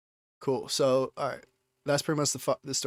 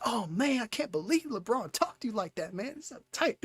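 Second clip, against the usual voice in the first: much higher, excited voice of a hyped friend; enthusiastic coworker; 9 semitones higher, 3 dB quieter than usual. Retold or imitated. Friend Jamone amazed LeBron spoke to him, excited. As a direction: gush about it like a thrilled buddy. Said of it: "super enthusiastic".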